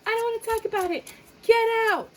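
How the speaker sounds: high, loud shrieking mock tantrum; angry partner. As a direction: scream it like a fed-up partner in the car. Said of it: "They were like".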